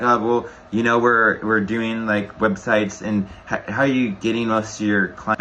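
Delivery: slow, hesitant, listless delivery; low energy. Shown as a demonstration. Coach imitating a weak salesperson, slow and listless. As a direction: drag through it without energy. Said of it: "that's the worst you could be".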